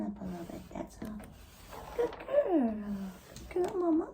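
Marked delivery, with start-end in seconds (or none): 2.0-4.1 high, tender pet talk; cooing praise. Real, not acted. Owner praising the brave mama, high soothing coo.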